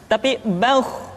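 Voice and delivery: ordinary delivery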